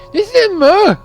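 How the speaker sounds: very high, loud mocking squeal; exaggerated, high-pitched jeering imitation; mocking mimicry. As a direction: mimic a beggar in a piercing high whine. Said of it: "Oh wait, he's mimicking".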